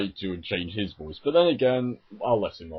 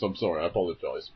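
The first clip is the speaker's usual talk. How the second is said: ordinary talk, nothing marked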